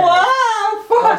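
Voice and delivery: high, loud startled cry; shocked outburst. Real, not acted. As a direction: yelp in shock. Giant warrior bursts in.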